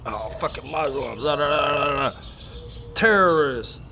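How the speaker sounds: sarcastic parrot of the talking heads; mock sneer. Talking about 9/11 blame, he mimics people with a mock sneer. Mocking what people kept saying.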